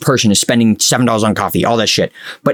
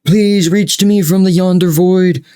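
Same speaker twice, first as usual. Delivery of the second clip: higher, hammed-up plea to the void; mock pleading; 6 semitones higher, much slower than usual. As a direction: beg Kevin theatrically, drawing out the last please. Mock plea to Kevin O'Leary.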